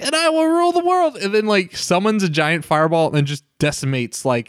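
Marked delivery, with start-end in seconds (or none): 0.0-1.2 much higher bratty villain cry; megalomaniac rant. Retold or imitated. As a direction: shriek it like a kid tyrant. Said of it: "he's like".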